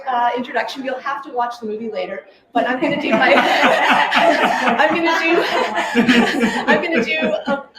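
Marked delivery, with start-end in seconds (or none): 3.4-4.7 a loud burst of real laughter. Real, not acted. Giggling before starting the song. 5.5-6.6 a short real laugh. Real, not acted. Giggling before starting the song.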